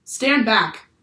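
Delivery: a loud, firm order; yelling. A narrator's reading. Captain yells at Annie to stand back, loudly. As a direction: bark it at the girl. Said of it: "the captain yelled at her".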